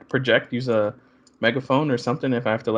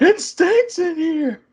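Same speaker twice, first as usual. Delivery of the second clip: high, loud, very drawn-out yell; mock shouting; 5 dB louder, much slower than usual. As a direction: shout a tactless remark slowly. Joke about only being able to shout.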